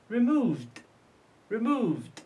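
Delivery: "removed" slowly, ending in a voiced d; final d sound. Shown as a demonstration. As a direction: say "removed" with E D as just d. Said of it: "E D d? Because it's preceded by".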